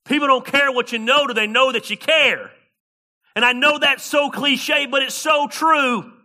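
rising pulpit fervor; full-force preaching. Acted. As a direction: preach it hard to the congregation.

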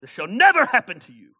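high, very loud put-on accent; Peter, accented outburst. Disciple rebuking Jesus, loud exclamation in a put-on accent. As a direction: exclaim it loudly in a comic accent. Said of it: "I think he has that accent".

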